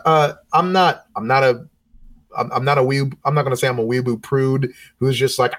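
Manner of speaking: usual speaking voice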